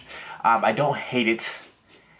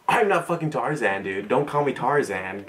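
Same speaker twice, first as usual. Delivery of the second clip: normal talking voice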